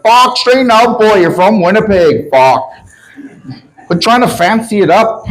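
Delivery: acted indignant shout, pitched high; imitated scolding heckler. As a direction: yell it out like someone butting in from the corner. Heckler calling out from the corner.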